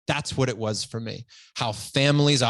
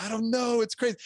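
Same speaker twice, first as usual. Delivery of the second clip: much higher, marvelling at the surprise; amazed delight; 9 semitones higher, faster than usual. Retold or imitated. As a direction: answer the wife in wonder. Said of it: "And I'm like".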